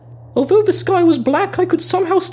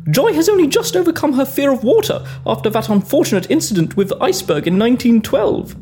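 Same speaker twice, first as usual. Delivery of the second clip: ordinary delivery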